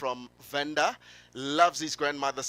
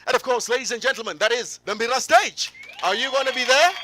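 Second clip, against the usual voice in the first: high, loud, excited host; raised-voice event announcer pitch; rallying the crowd; 9 semitones higher, 7 dB louder than usual. Real, not acted. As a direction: hype the stage to the audience. Host rallies audience to the stage.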